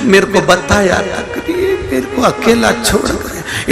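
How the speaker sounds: badly faked tearful lament of a wife; mock wailing widow. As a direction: whimper it out like a comic widow. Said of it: "क्यों रो रही है?".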